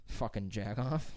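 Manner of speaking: ordinary delivery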